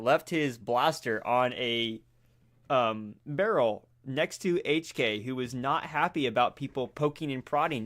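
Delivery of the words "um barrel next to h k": ordinary delivery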